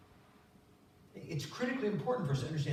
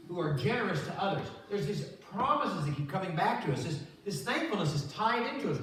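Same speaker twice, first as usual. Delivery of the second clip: fervent preaching; 4 semitones higher, 5 dB louder than usual. Acted. Preaching at rising force.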